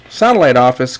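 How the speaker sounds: ordinary talk, nothing marked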